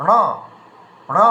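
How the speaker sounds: র said through the nose; nasalised r demo. Shown as a demonstration. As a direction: let র ring through the nose. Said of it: "দেখুন নাকে চলে গেছে".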